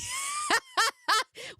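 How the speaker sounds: high shouted laugh; shrieking laugh. Real, not acted. Bursts out laughing at joke.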